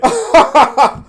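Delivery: high, loud cackling laughter; big burst of real laughter. Real, not acted. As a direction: crack up at your own joke. Cracks up at own joke.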